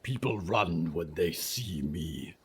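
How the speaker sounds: low, rumbling monster voice; low creature voice. Acted. Creature speaks.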